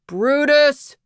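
high, loud shout of a man calling his dog; thundering call. A narrator's reading. Man calling his overexcited dog back across the park, thundering. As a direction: call out sharply for a runaway dog. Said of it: "The man's voice thundered across the park".

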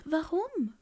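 high, soft little bird question; Ara fragt. Acted. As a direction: ask it small and high. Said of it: "fragte Ara". (hohe, leise Vogelfrage)

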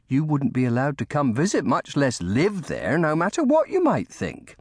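high-pitched servant voice, gently scoffing; fond nursemaid. A narrator's reading. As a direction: talk a child out of a daft idea. Lucy dismisses the boy's idea.

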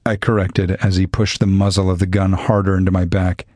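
usual speaking voice

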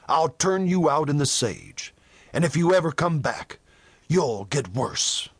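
plain reading voice

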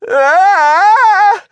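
long, very high, loud scream. A narrator's reading. Shivers screams with sunscreen in the eye. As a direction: let out a sustained piercing scream. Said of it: "Shivers screamed".

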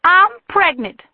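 desperate outburst. A narrator's reading. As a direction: let it burst out. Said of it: "burst out of her on a flood of desperation".

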